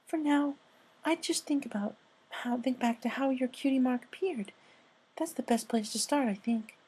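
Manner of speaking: high, soft, timid pony voice; high soft shy pony; Fluttershy voice. Acted. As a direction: speak like a shy gentle mare.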